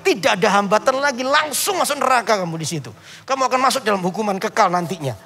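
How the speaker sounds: preaching. Acted. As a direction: preach hell's toll road hard. Preaches to boy at full force.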